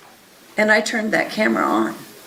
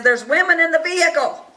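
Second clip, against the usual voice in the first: a mild mock yell, pitched higher; retold scream; 7 semitones higher than usual. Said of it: "he screams back at the people".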